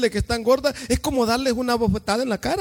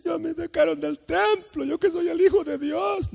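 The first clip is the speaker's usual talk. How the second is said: higher, whimpering self-pity; mock whiny lament; 6 semitones higher, slower than usual. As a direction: whine like a hurt victim. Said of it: "salió llorando y contándole a la gente". (queja llorona y aguda, en burla)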